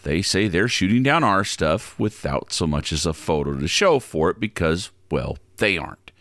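low, deadpan explainer voice; low conspiratorial host. Acted. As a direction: lay it out calmly and low. Different low-voiced segment.

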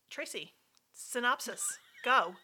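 ordinary talk, nothing marked

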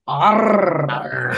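gruff pirate growl. Pretend. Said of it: "with my best pirate voice".

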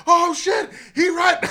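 very high, loud mock outburst; imitating a whooping, delighted reaction; hollering in hilarity. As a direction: shriek it like a cracked-up onlooker. Imitates the room losing it.

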